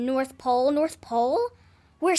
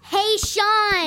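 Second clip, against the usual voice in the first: loud, higher, impatient holler; yelled call; 4 semitones higher, 7 dB louder than usual. Acted. Girl shouts for her ignoring babysitter, impatient yell. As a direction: yell to make him finally answer.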